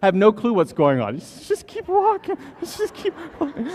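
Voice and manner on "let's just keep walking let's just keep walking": mock nervous parent. Parent voiced urging to move on.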